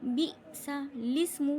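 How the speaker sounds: "बिसा लिस्मु" chanted slowly, split bi-sa-lismu; correct joining. Shown as a demonstration. Teacher showing correct reading, slow "बिसा लिस्मु". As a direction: recite "बिसा लिस्मु" syllable by syllable. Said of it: "पढ़ा क्या जाएगा?".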